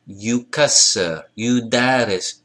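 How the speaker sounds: slow you kasser, you daris with long oo first; clear opening oo. Shown as a demonstration. Teacher pointing out the oo, you kasser, you daris slowly. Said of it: "If you hear that oo".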